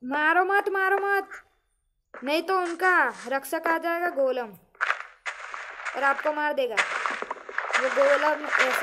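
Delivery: urgent shout. Real, not acted. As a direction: yell at him to stop right now. Stopping teammate killing villagers.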